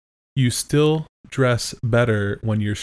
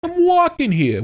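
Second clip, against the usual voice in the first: much higher, louder mock New York accent; 6 dB louder than usual. Co-host imitating the host's New York side. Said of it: "That's how it would go".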